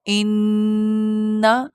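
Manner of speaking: "انا" with the doubled نون hummed long through the nose; held nasal ghunna. Shown as a demonstration. Teacher showing students how to say انا, long nasal hum. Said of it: "تو اس کو کیسے ادا کروں گی میں؟".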